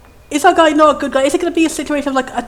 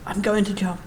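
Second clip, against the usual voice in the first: low, quiet jumper; 6 semitones lower, 9 dB quieter than usual. Acted. Jumper repeats his threat.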